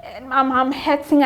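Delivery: fighting back tears while telling it; choked-up storytelling; voice breaking. Real, not acted. Recalling postpartum disappointments, emotional.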